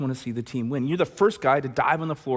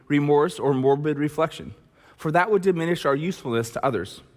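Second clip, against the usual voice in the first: measured reading of a recovery text; reading aloud; 4 semitones higher than usual. Acted. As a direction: read it out like a passage of scripture. Reading step eleven aloud.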